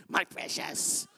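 slow, breathless whispered creature hiss; Smeagol. Retold or imitated. Pastor mocking money-lovers with a slow Gollum whisper. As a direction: rasp it out like a miser cradling his ring. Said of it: "it's like Schmiegel with the ring".

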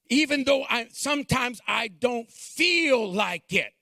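building from plain talk to high, loud, shouted preaching; full-force preaching. Acted. Pastor urging the congregation toward salvation, preaching at full force. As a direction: climb into a fiery altar-call shout.

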